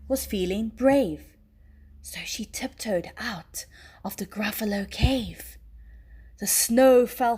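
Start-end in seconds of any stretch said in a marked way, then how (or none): 2.0-5.6 hushed whisper; whispered narration. A narrator's reading. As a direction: whisper as if sneaking out. Tiptoeing out at night.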